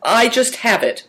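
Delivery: put-on high-pitched, halting boy; hesitant young character, pausing after the first word. A narrator's reading. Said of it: "said Big Tiger hesitantly".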